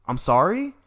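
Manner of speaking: retold mild shock. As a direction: caught off guard in front of family. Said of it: "and I went".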